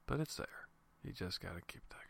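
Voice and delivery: whispered encouragement. Real, not acted. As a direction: drop to a whisper for the secret. Quiet encouragement about digging on.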